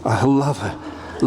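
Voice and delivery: slow, low, self-indulgent plea; a lounging lover asking to be pampered; languorous indulge me. Shown as a demonstration. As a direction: sink lazily into it. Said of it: "Languorous, self-indulgent".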